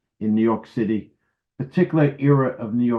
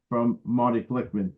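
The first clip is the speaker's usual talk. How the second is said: ordinary delivery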